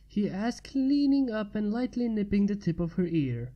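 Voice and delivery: ordinary delivery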